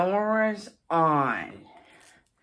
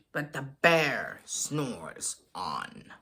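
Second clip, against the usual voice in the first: slow low refrain; 10 semitones lower, 5 dB quieter, slower than usual. A narrator's reading. Sleepy refrain.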